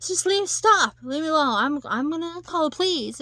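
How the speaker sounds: high, loud acted outburst; snapping. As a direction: act out a panicky demand to be left alone. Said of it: "then she snaps".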